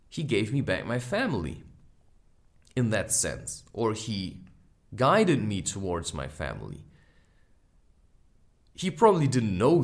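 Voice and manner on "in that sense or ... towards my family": ordinary talk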